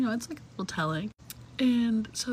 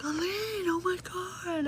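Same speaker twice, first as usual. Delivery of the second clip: much higher, gushing "oh my God"; higher mock greeting; mock excited friend; 9 semitones higher, slower than usual. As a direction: squeal like a friend arriving by surprise. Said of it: "she pulls up and she's like".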